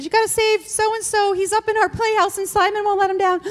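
put-on high little girls' voices, pleading; high childish plea; kids pleading. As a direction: imitate the girls begging mom to rescue the boy. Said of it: "the girls come running in the house going".

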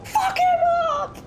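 very high, cracking-up-the-pitch cheer; high fight-crowd shout; excited yell. Real, not acted. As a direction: scream encouragement at the fighter.

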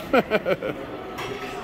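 soft high giggle; quiet, high chuckle at your own remark; amused laugh. Real, not acted. Vlogger poking fun at goofy merch, soft high chuckle.

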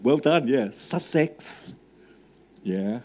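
ordinary talk, nothing marked

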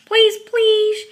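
very loud, high begging; shrieked child's begging. A narrator's reading. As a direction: yell the plea for everyone to hear. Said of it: "so loudly that the whole".

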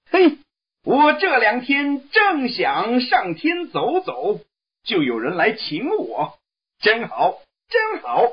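high, eager monkey voice, bubbling over; delighted Monkey King. Acted. Monkey King thrilled that heaven invites him, high gleeful voice. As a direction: squeal with glee like a cheeky monkey. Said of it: "高兴地说".

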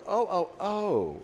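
high, drawn-out imitated oohs; awkward realization. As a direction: mimic a lady slowly realizing her blunder. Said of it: "she's now awkward".

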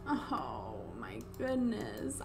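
slow, stunned whisper; hushed, drawn-out disbelief; speechless. Real, not acted. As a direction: barely get it out, whispering. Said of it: "I can't even talk".

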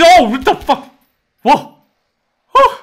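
sudden high, loud startled yelp. Real, not acted. Scared by his own reflection, a startled yelp. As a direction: jump at your own reflection. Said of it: "I may have overreacted".

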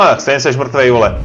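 ordinary talk

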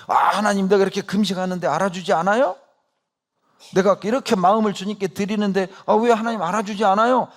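whiny complaint. Retold or imitated. Preacher mocking fasting believers who complain to God, whiny. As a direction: imitate a self-pitying believer nagging God. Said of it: "그러면서 하는 말이".

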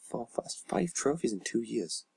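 ordinary talk, nothing marked

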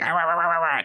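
high-pitched mock angry yammering; angry little racers. Describing cartoon racers' angry faces, mock angry yammer. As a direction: growl like tiny furious cartoon racers. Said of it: "little racers are little angry faces".

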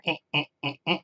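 short, quiet repeated "eh" blips; little staccato electronic noise bursts; imitated switch noise. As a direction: mimic a switch chattering on and off softly. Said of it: "then this switch will go".

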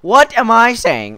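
loud, high, drawn-out self-rebuke; slow exasperated yelp; exasperated outburst. Real, not acted. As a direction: cry out in disbelief at yourself. Snapping out of own rambling.

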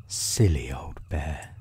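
low, soft, tender murmur; gently fond, voice dropped; loving. A narrator's reading. Christopher Robin comforting stuck Pooh, soft loving voice. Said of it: "in such a loving voice".

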